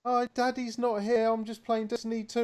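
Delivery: put-on high squeaky child's voice; squeaky little girl. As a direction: chirp it like a small daughter. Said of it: "this little squeaky voice came on".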